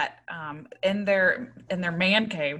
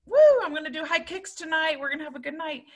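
cheerleader; 9 semitones higher, faster than usual. Retold or imitated. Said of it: "I always joke around with friends like".

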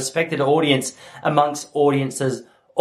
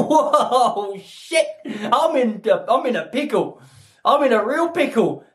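high-pitched panicked realisation, half laughing; comic panic; 6 semitones higher, slower than usual. Retold or imitated. Husband realising he forgot Mother's Day, comic high panic. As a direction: act out being caught out, voice jumping up.

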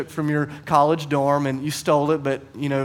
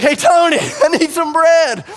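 bellowed request, played for laughs; hollering for a neighbor; 11 semitones higher, 8 dB louder than usual. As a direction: shout your plea through a shut door. Hollering at neighbor's door for bread.

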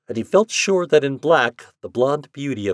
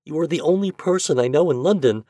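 pleading; 6 semitones higher than usual. Acted. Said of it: "she begged".

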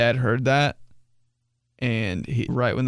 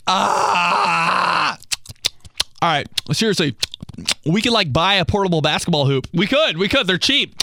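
annoying goatee guy; 6 semitones higher than usual. Retold or imitated. Annoying guy pitching a basketball hoop, hyped high voice. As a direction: hype up a dumb idea. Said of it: "It's like".

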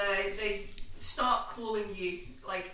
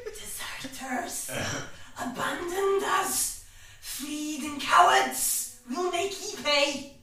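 slow, higher Scottish ghostly accusation; 5 semitones higher, slower than usual. Acted. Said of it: "Scottish voices".

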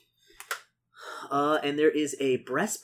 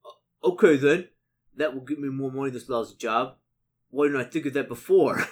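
half ogre; 6 dB louder than usual. Acted. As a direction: give the half ogre a dawning realization. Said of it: "across the half ogre's face".

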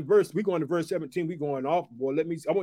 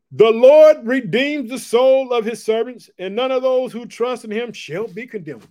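much louder, higher proclamation; loud scripture proclamation; 5 semitones higher, 9 dB louder, slower than usual. Acted. Preacher booming the psalm to the persecuted church. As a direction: boom the verse out. Preacher reads last verse.

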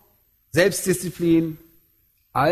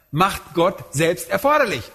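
usual speaking voice

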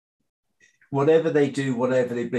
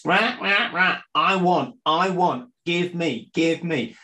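put-on demanding baby; 6 semitones higher than usual. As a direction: play a greedy baby yelling for milk. Acting out a screaming baby.

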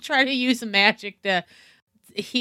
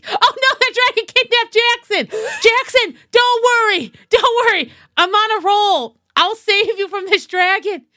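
high, loud heroic call; 8 semitones higher, 4 dB louder than usual. Acted. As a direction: shout after Jackson. Zelda calls to kidnapped Jackson.

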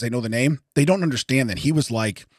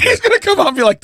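high, fast, excited; excited high pitch; 5 dB louder, faster than usual. Real, not acted. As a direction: rush it out, voice jumping up. Excited retelling.

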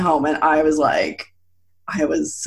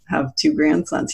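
usual speaking voice

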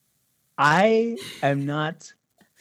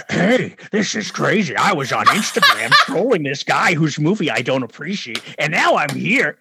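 louder, mocking dude voice; forty-year-old troll; 3 dB louder than usual. Filmmaker mocking a middle-aged Instagram troll, goofy voice. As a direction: mimic a goofy online troll, amused. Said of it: "some forty-year-old dude going".

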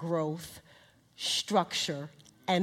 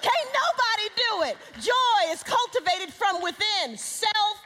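raised, ringing sermon voice; preaching at full force; 10 semitones higher, 4 dB louder, slower than usual. Acted. Preacher proclaiming fruits of the Spirit, raised and ringing. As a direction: drive each fruit home with fervor.

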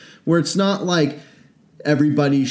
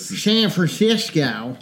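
put-on slow old prospector accent with slushy s, "San Francisco" as "Shan Franshishco"; old-timey miner accent; 2 semitones higher, slower than usual. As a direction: ham up a gold-rush miner. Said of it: "Funny accents".

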